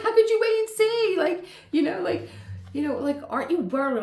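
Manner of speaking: high, loud, laughing exasperation; pleading, laughing in disbelief; exasperated. Retold or imitated. To her boss, loud exasperated pleading. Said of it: "And I'd be like".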